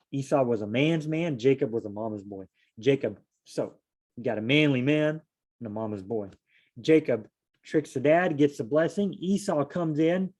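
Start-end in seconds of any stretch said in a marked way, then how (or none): none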